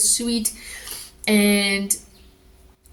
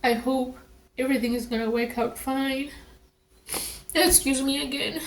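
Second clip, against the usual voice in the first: higher voice, choking up for real; close to tears; 6 semitones higher, 3 dB quieter than usual. Real, not acted. Scared mother recalling her hope for baby, tearful. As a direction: struggle through the hope, then excuse yourself. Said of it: "I'm gonna cry later on now".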